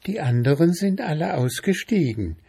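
high-pitched tiny mushroom; squeaky little voice. A narrator's reading. Little chanterelle explaining to the bear, squeaky high voice. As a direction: pipe it up in a small high voice. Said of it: "mit einem so piepsigen Stimmchen".

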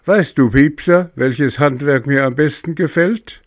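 high, loud mocking tone; snide. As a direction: needle the cricket. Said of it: "er sagte spitz".

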